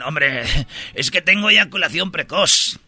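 put-on Castilian accent with lisped z; mock a man from Spain confessing; imitated Spain Spanish. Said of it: "En España dicen".

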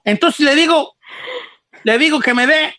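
ordinary talk, nothing marked

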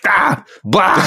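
high mock-commander grunts "Ah, blah"; imitated one-syllable Klingon orders; barked alien command noises. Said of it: "he's yelling out words".

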